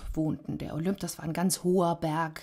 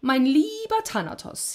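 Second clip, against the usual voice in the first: patronising; 9 semitones higher, 5 dB louder than usual. A narrator's reading. Unafraid Sisyphos lectures raging Death, patronising. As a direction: address him with lofty condescension.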